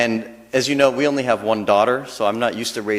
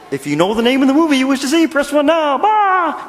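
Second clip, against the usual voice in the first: high, fast, louder puppet voice; Kermit the Frog; 13 semitones higher, 5 dB louder than usual. Pretend. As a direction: rattle the menu off like the frog puppet. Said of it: "maybe if Kermit the Frog did it".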